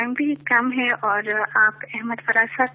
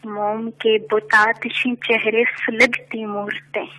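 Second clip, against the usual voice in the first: measured poetic recitation, lower; stately nazm recitation; 4 semitones lower than usual. Acted. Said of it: "ایک نظم پیش کرنا چاہوں گی".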